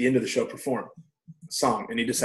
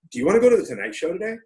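excited invitation; 7 semitones higher, 4 dB louder, faster than usual. Retold or imitated.

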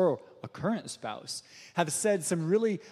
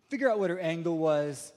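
ordinary delivery

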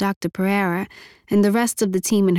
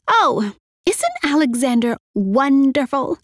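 gushing; 5 semitones higher than usual. Acted. Dogmore proclaims on screen.

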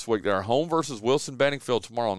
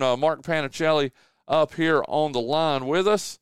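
ordinary talk, nothing marked

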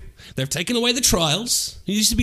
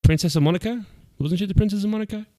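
usual speaking voice

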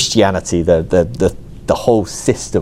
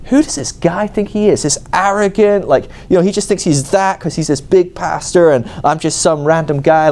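indignant rant; 7 semitones higher than usual. Retold or imitated. Inner rant at the pastor.

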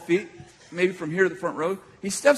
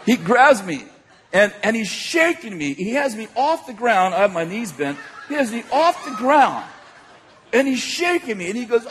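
ordinary talk

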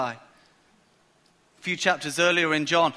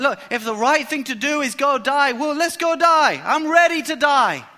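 eager resolve; 7 semitones higher, 5 dB louder than usual. Acted. Thomas voiced eagerly.

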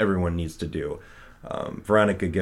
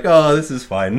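high, loud, laughing nonchalance; laughing, carefree; 7 semitones higher, 8 dB louder than usual. Retold or imitated. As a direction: toss it off with a laugh. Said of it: "walking out like".

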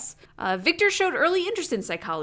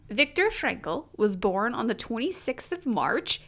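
ordinary talk, nothing marked

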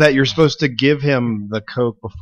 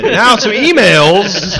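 drawn-out announcement; 7 semitones higher, 8 dB louder, much slower than usual. Pretend. Mock slow announcement.

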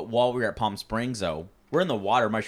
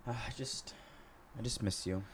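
soft wistful mom; 13 dB quieter than usual. Retold or imitated. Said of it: "My mom does the whole".

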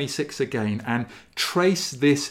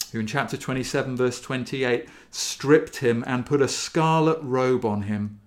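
normal talking voice

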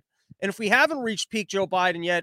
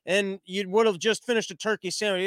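usual speaking voice

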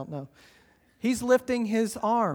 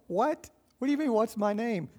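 higher, quick puzzled comeback; hammed-up confused "what?", pitched up; mock bafflement; 4 semitones higher than usual. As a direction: play a wrestler thrown by the question. Acting the baffled wrestler's reply.

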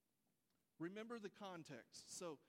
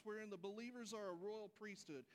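ordinary delivery

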